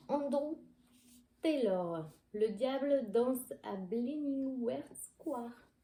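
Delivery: a lightly put-on English accent, slow on the title; clumsy English accent. Shown as a demonstration. Booktuber reading the English title to viewers, self-conscious English accent. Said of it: "mon accent anglais, c'est pas terrible du tout".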